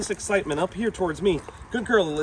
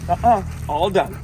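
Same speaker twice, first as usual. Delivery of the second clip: firm correction; 3 semitones higher, 7 dB louder than usual. Real, not acted. Correcting dog that won't settle.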